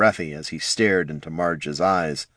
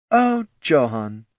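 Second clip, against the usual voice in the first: desperate casualness; 6 semitones higher, slower than usual. A narrator's reading. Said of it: "desperate casualness".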